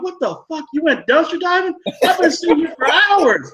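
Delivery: high, incredulous mock outrage; outraged disbelief. Stuck on the toilet for hours, high outraged disbelief. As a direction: squeal it in stunned disbelief. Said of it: "I'm like".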